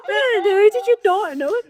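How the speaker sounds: high, loud laughing disbelief; laughing high-pitched outrage at the late reaction. Real, not acted. Laughing incredulous outburst.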